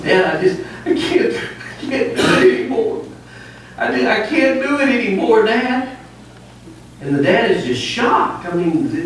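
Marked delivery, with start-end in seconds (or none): 0.0-6.0 high, hammed-up blubbering son; mock crying. Son to his dad, mock crying in a high voice. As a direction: whimper it like a heartbroken son, hammed up. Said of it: "he just busts out crying".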